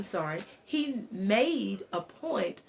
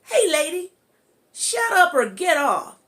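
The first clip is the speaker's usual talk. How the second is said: loud, much higher angry yell; driver shouting; 9 semitones higher, 11 dB louder than usual. Acted. Bus driver yelling at the screaming lady, loud and angry. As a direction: bark it at the rowdy passenger. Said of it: "When the driver shouted".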